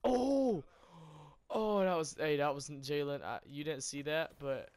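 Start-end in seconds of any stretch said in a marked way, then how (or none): 0.0-3.2 excited outburst. Real, not acted. Gamer reacting to a sudden moment in game, loud surprised outburst. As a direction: cry out, startled by the play.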